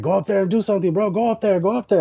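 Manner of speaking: high, pushy hype from the homies; mock-urging friends' voice; urging friends. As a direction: egg him on like buddies at a party. Friends urging him onstage.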